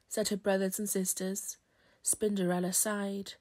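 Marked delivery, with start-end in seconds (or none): none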